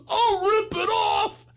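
high, slow hammed-up threat; mock furious threat. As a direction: squeal the threat like a scared kid. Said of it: "He goes".

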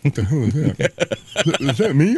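slow, puzzled mock discovery; slow question, newly hearing kid; imitating startled kid. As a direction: act startled by your own voice. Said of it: "it's like".

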